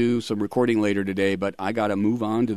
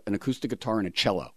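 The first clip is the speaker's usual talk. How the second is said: ordinary talk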